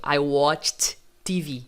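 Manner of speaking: WATCHED slow and careful, "watcht"; correct ED as T. Shown as a demonstration. Teacher redoes the corrected sentence, ED as T. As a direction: separate the final T of WATCHED from TV.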